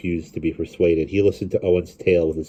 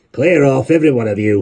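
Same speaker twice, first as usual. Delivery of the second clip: louder, higher order; shouting; 7 semitones higher, 7 dB louder than usual. A narrator's reading. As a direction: shout the crowd away. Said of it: "he shouted".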